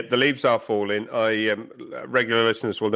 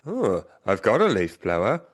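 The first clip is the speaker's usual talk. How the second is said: imagined thought; slower than usual. Retold or imitated. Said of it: "I thought".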